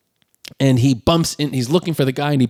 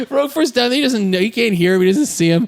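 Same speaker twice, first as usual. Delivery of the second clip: ordinary delivery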